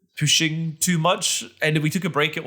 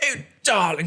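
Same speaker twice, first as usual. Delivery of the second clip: put-on plummy English accent, a hint; lightly posh English darling; 6 semitones higher than usual. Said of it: "Can I get a little bit of the voice?".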